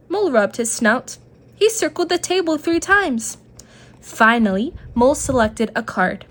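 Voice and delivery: plain reading voice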